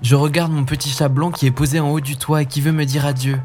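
put-on a boy making an innocent excuse; innocent boy Hansel.

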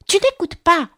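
high, sharp telling-off from a mouse; stern reproach. A narrator's reading. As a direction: scold sternly, pitched well up. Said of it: "d'un ton sévère". (ton sévère de reproche)

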